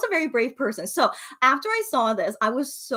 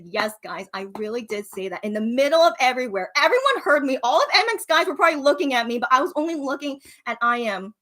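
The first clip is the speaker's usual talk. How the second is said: usual speaking voice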